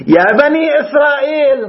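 high ringing stress on بني; emphatic call. Real, not acted. Quoting Quranic address emphatically.

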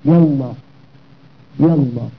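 "يا الله" linked as yallah, no glottal stop; short joined "يا الله", hamza dropped; hamza elided. Shown as a demonstration. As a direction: glide from "يا" into "الله" as one word. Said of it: "وبوصلها أي بعدم نطقها".

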